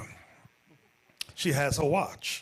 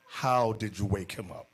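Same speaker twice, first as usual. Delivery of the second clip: ordinary delivery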